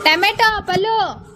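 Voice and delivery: high, loud shout to someone; ringing high-pitched call. Real, not acted. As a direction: yell it out to get their attention. Calling out to Pallu about tomato.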